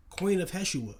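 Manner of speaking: high, loud acted-out exclamation; excited call. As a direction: imitate a thrilled stranger calling out. Acting out excited stranger calling out.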